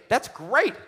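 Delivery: high, loud, playful burst; delighted exclamation. Acted. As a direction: say it like a delighted joke. Joking about Adam and Eve naked.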